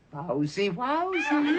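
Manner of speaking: high squealing wowsy, a camp dog voice; squeaky camp dog cry; mock effeminate dog. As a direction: yelp it like a prissy dog. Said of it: "and goes".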